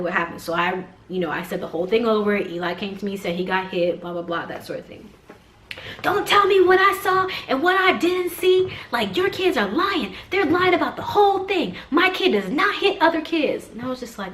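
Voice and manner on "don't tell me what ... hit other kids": high, loud mock tirade; irate mother. Irate mom insists her kid does not hit, yelling. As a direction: imitate an outraged mom shouting someone down.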